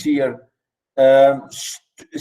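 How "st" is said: ordinary talk, nothing marked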